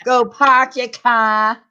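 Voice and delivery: slow demo of a Boston accent. Shown as a demonstration. Streamer demonstrates after laughing, Boston accent. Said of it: "That was my Boston accent".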